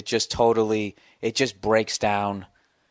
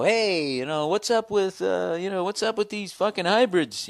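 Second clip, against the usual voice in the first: high, goofy comic-host impression; lightly mock the show host's banter; 8 semitones higher than usual. Host imitated on stage, high voice.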